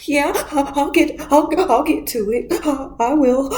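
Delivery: high, halting sobbed promise; mock crying. Bride promising the inmate money, mock crying. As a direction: stammer through hammed-up tears. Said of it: "she starts crying".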